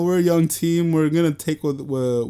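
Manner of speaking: ordinary delivery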